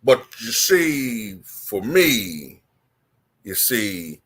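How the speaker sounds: slow, articulate, deliberate; Wilt Chamberlain voice. Shown as a demonstration. Answering a goat question as Wilt, slow and deliberate. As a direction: pronounce each word slowly, like a dignified old legend. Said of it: "he would go like".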